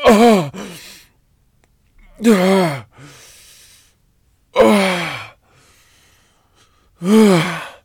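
very high, loud ecstatic cries; climaxing. Acted. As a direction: cry out at the peak.

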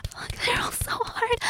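high whimpering whisper. Acted. Watched, aroused roleplay.

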